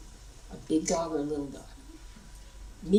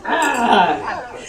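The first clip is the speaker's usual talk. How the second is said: loud Ah breaking into laughter; laughing roar; 4 semitones higher, 10 dB louder than usual. Shown as a demonstration. Teacher repeats the clawing roar for the group, laughing. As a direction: roar it again, laughing through it. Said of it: "let's claw it with the sound".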